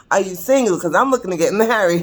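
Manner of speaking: high, loud mock proposition. Elderly gentleman repeating his proposal to her, hammed-up and loud.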